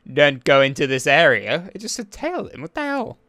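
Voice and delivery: loud, exasperated complaint; exasperated rant. Real, not acted. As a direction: rant at the game, fed up. Game ignored his tailing, frustrated.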